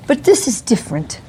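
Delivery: ordinary talk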